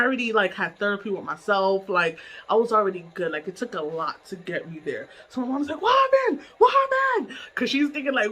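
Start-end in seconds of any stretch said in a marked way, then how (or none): 5.8-7.4 high, loud urgent questioning; retold alarmed mother. Mom fearing what else happened, high loud alarm. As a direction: cry out as a panicked mom.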